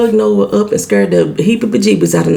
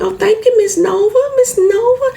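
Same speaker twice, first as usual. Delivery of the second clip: put-on high, sweet little girl voice, much higher; girlish and sweet, pitched way up; sweet Southern girl; 14 semitones higher than usual. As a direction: play the polite little Southern belle. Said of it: "The youngest daughter hugged her up like".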